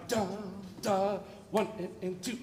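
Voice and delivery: each "da" hit, then allowed to fade; accented downbeats. Shown as a demonstration. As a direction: stress "one" and "two", dropping back on "and". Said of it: "I want accents on".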